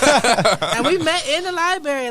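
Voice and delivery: laughing through the words. Real, not acted. Telling how she met her boyfriend, laughing. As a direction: say it with a laugh caught in it. Laughing while recalling meeting.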